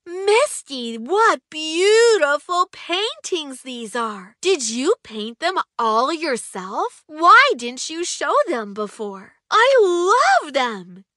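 an excited friend gushing over the paintings, loud and high. Acted. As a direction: gush with excitement. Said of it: "jumping around excitedly".

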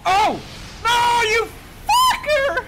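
loud, high furious yell; angry shout. Real, not acted. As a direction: curse the killer loudly. Killed by enemy.

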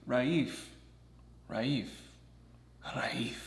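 trying out "Raif", rah-eef, with pauses; practicing Raif. Shown as a demonstration. Practicing his new name for introductions as rah-eef. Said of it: "get used to that".

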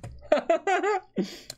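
a short real laugh at the joke; amused laugh. Real, not acted. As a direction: burst of genuine laughter. Reacting to a funny quote.

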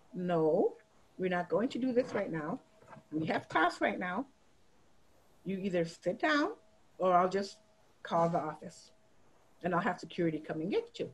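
low, soft, measured refusal; calm, firm teacher. Retold or imitated. Teacher warning a defiant student to sit down, calm.

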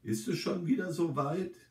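mildly astonished. A narrator's reading. Old resident learns tomorrow is Christmas Eve, mildly astonished. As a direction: ask it in mild surprise. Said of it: "reagiert sie verwundert".

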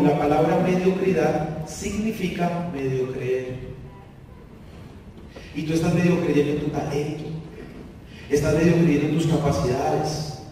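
low, measured heart-to-heart; boss's gentle counsel. Retold or imitated. Boss counseling his employee over coffee, low and gentle. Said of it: "me estaba tomando el café".

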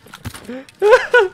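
loud high cackle of laughter; laughing. Real, not acted. Player realising the bot was a real person, loud laughing. Finds the enemy was human.

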